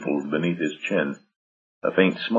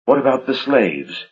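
demanding; 7 semitones higher than usual. A narrator's reading. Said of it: "Valerie demanded".

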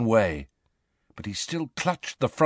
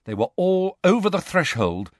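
ordinary delivery

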